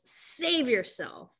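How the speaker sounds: slow, booming mock yell from within; booming inner voice. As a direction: deliver it like a commanding voice in her head. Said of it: "booming voice, female voice, strong, powerful ... that yells".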